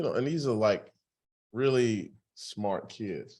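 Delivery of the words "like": ordinary talk, nothing marked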